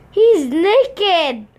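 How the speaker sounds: high, loud exclamation; scandalized high-pitched yelp; shocked outcry. Real, not acted. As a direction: cry out in shock. Shocked at naked character.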